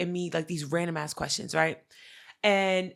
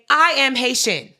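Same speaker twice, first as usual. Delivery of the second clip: louder, higher retold announcement; emphatic declaration; 6 semitones higher, 10 dB louder than usual. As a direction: proclaim it loudly, retelling your own words. Reluctant announcement of identity in story.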